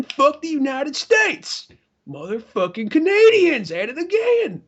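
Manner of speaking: high, drawn-out indignant cry; mock outrage. Patriot finds the song insults United States, mock outrage. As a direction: act the betrayed patriot.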